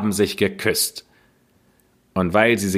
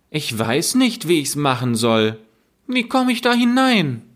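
put-on higher, innocent girl voice climbing to a high question; feigned naive girl; 7 semitones higher than usual. Gretel pretending to the witch she cannot get in, high and innocent. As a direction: play dumb, sweetly. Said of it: "und sprach".

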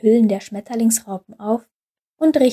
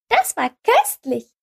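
hohe, piepsige Vogelstimme; 10 semitones higher than usual. Acted. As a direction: sprich wie ein kleiner zwitschernder Vogel. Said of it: "sagten die Vögel". (hohe Vogelstimme)

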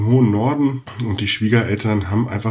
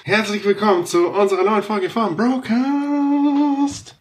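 announcer voice; 12 semitones higher, slower than usual. Acted. Feierliche Begrüßung zur neuen Folge.

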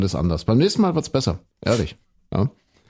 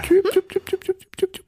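high bird chirps; robin chirping. Acted. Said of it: "Das ist das, äh, Rotkehlchen".

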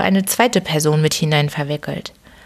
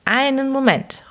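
even higher, plaintive repeated plea; old man repeats his whining call, pitched higher; 8 semitones higher than usual. A narrator's reading. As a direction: wail it again, more desperate. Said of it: "rief eine jammernde Stimme".

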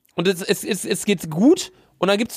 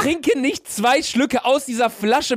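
indignant refusal; 7 semitones higher, slower than usual. Real, not acted. Refusing to drink from bottle.

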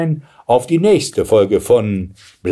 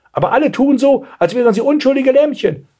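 high-pitched outraged grandmother; indignant; 3 dB louder than usual. A narrator's reading. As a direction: protest in shrieky indignation. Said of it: "empörte sich die Großmutter". (empörte Großmutter, hohe Stimme)

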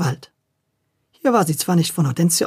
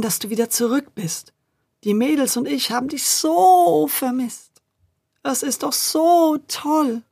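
soft voice; 5 semitones higher than usual. A narrator's reading. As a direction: coax him with a soft voice. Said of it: "und sprach mit sanfter Stimme".

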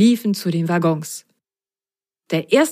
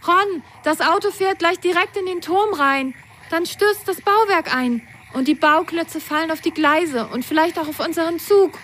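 high, urgent warning cry; excited; 10 semitones higher than usual. A narrator's reading. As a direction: call out in alarm to Ron. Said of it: "Lili rief aufgeregt".

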